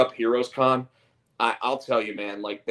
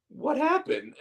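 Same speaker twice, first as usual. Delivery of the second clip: much higher mom voice asking; worried mom, high. Retold or imitated. Mom to her upset kid, very high concern. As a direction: pitch way up like a fussing mother. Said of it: "And the mom's like".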